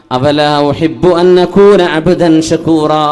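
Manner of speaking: slow, sung Arabic chant; melodic Arabic recitation. Acted. Preacher chanting the Prophet's reply to the congregation, slow and sung. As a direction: intone it slowly like a recited hadith.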